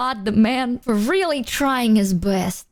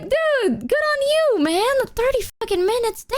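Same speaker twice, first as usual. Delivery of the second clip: high-pitched amazed praise; retold gushing kudos, voice way up; impressed congratulation; 12 semitones higher than usual. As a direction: applaud him in a high, astonished voice.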